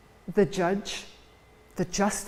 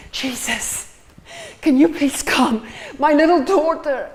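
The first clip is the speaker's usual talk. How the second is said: a father's urgent plea, getting louder; pleading father; 4 semitones higher, 4 dB louder than usual. Acted. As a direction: beg for help for your little girl. Father begs Jesus for daughter.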